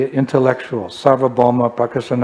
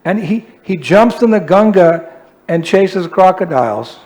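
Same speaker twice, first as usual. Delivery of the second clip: higher, louder fed-up complaining; exasperated complaint; 6 semitones higher, 6 dB louder than usual. Retold or imitated. Haridas complaining to Mahaprabhu about his partner, exasperated and loud. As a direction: vent to the master, exasperated. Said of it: "He'd say".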